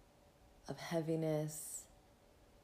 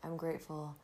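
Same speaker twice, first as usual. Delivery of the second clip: breathy calm, guiding the listener inward; soft spa whisper; 3 dB quieter than usual. Acted. Leads a gratitude meditation.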